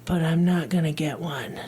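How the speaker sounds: resigned unvoiced whisper. Real, not acted. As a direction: whisper it, worn out. Weary wish for a vacation.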